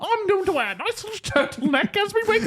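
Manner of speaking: very high, babbling cartoon Brit; Montgomery caricature. Montgomery babbling about the great match, absurd high caricature. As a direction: run on in a hammed-up high British voice. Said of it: "him being like".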